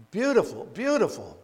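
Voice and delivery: delighted emphasis. Real, not acted. Preacher savoring the scene.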